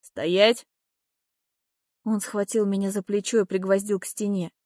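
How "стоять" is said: barked loud order; loud command. A narrator's reading. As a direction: stop her dead, loudly. Man stops her leaving.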